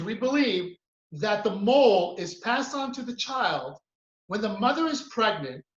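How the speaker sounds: normal talking voice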